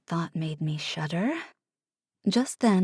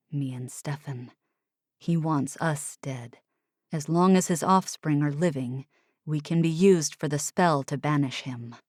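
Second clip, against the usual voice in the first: low grim Oliver; 5 semitones lower than usual. Acted. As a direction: lay it out low and heavy. Oliver explains grimly.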